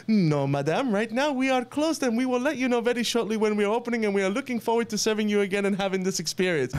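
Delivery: high, rapid mock-French aristocrat; imitated French-accented maître d' on the phone; [name]'s French accent. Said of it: "like a French accent".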